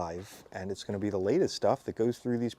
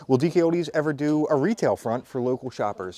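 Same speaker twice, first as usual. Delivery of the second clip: normal talking voice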